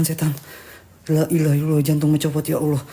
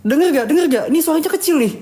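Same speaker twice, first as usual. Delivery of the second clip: high, urgent asking if they hear it; alarmed excitement; 8 semitones higher than usual. Real, not acted. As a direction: push the pitch up, startled and eager. Hearing a woman's laugh. (panik bertanya ke penonton, suara tinggi)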